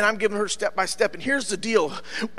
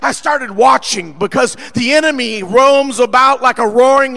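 forceful preacher, punching key words loud; preaching; 3 semitones higher, 6 dB louder than usual. Real, not acted.

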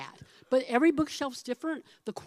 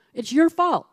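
accusing quote; 5 semitones higher, 9 dB louder than usual. Retold or imitated. Retold owner blaming the master.

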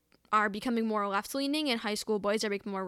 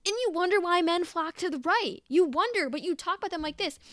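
higher, louder fed-up retort; incredulous frustrated outburst; exasperated; 7 semitones higher, 3 dB louder than usual. Real, not acted. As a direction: throw it back at them, exasperated. Frustrated rebuttal to tweets.

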